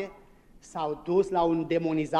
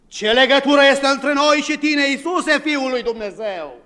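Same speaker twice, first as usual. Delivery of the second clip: terrified spirits; 8 semitones higher, 8 dB louder than usual. Retold or imitated. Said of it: "cuprinse de groază".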